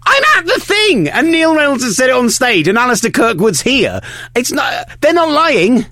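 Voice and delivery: mock-indignant, very high-pitched insistence; high-pitched protest. Host re-enacting his tweet to a doubter, high-pitched insistence. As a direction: squeal out your defence in a strained high voice.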